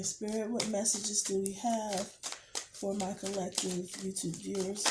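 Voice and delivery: soft, reverent call to Spirit; hushed invocation. Acted. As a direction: murmur the question to Spirit. Asks Spirit again.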